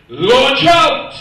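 preacher proclaiming Jesus' words; preached command. Acted. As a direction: call it out like a command.

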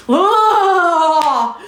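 long cry of fury; drawn-out exasperated scream; enraged scream. Real, not acted. Mother overcome by rage about her child, screaming.

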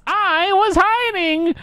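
very high, drawn-out creepy squeak; Twitch voice. Retold or imitated. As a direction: stretch it out like a sneaky rat. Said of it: "that's one of his voice lines".

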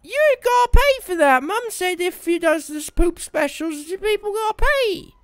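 high squeaky silly voice; squeaky put-on voice. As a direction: squeak it out like a cartoon kid.

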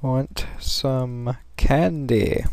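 slow, soft creepy stranger voice; creepy candy offer. Pretend. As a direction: lure them in, slow and hushed. Creepy stranger line at candy house.

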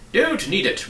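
loud, high dismissal; emphatic reply. A narrator's reading. Duke answering Milo about his car, loud and dismissive. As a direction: brush it off loudly and brightly high. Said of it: "replied the Duke".

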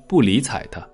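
ordinary delivery

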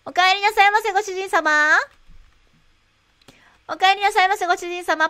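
high, loud maid welcome; sugary maid cafe greeting. Pretend. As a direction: greet your master sweetly. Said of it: "メイド風なコンビニ".

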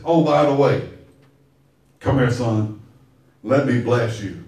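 put-on low, soft old father's voice; a tender old man calling his son near; old father about to bless, low and soft; old Isaac.

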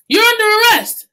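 high, loud commanding arrest; stern police officer. Acted. Officer arresting Bate in the kitchen, loud and stern. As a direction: bark the arrest with authority.

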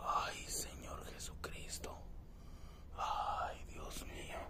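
terrified whispered prayer; frightened whisper. Real, not acted. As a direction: breathe out a scared prayer.

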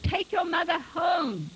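high, slow, carrying command; called out. A narrator's reading. Father ordering son to take mother home, calling out. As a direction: call the order out firmly. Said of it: "Retief called".

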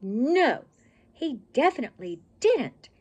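loud, emphatic refusal; firm storyteller's no; dreadful tone. A narrator's reading. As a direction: punch "No" and "didn't" high and loud. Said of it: "in a dreadful tone of voice".

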